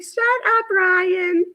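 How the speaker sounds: high squeaky mock protest at her son-in-law; mock mother-in-law. Mom squeals back at teasing son-in-law Ryan, mock high protest.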